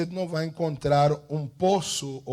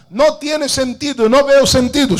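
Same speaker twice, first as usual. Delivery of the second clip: high, loud mock complaint; loud, high cry of someone giving up; doubter's protest; 9 semitones higher, 11 dB louder than usual. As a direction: voice the objector, pitched right up. Voices the doubter's objection.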